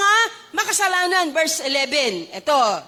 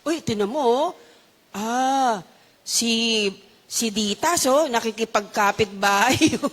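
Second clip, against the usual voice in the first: lower-pitched mock gossip; 4 semitones lower, slower than usual. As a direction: point out a neighbour like a busybody. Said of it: "marites agad". (kunwaring tsismosang marites)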